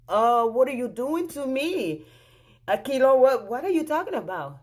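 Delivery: high, loud baffled questioning; retold indignant demand to explain; acting out growing disbelief; alarmed confrontation. Confronting husband on the phone.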